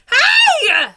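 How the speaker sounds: sudden high, loud shout. Acted. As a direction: yell to scare the crocodile off. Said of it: "he shouted".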